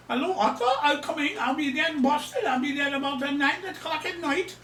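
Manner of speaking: high-pitched imitation of a Nigerian woman's accent. Scam girlfriend phoning about her Boston arrival, mock Nigerian accent. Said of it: "strong Nigerian accent".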